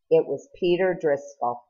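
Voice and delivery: ordinary delivery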